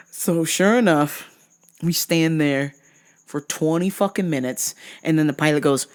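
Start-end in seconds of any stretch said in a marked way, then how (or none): none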